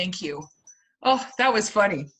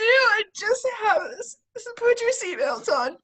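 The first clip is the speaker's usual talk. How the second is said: excited alien mom; 6 semitones higher than usual. Acted. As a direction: shout the order at the kids. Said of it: "I do".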